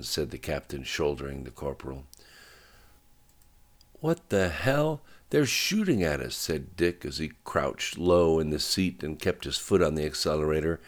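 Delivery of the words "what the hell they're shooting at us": high-pitched startled outburst; startled, higher voice under fire; alarmed disbelief. Acted. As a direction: react in shocked alarm, pitch jumping. Driver realizes they are shot at.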